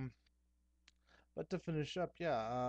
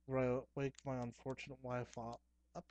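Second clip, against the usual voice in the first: ordinary talk, nothing marked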